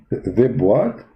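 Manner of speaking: ordinary delivery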